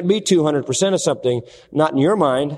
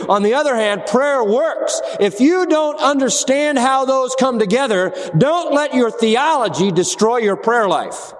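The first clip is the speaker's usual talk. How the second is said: raised and emphatic, hammering each claim; preaching with force; 6 semitones higher than usual. Acted. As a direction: preach it with rising conviction. Preacher presses the point harder.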